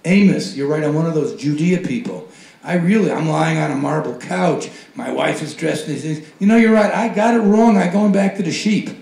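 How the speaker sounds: hammed-up, loud, exaggerated agreement; mock repentance. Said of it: "I'm not gonna say".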